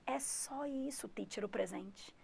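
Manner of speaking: soft unvoiced whisper; a whispered aside. Real, not acted. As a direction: whisper it like a secret.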